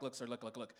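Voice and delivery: low, soft urgent begging; mild mock nervous whisper; nervous plea. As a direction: hush and plead with the cop. Pleading quietly with cop.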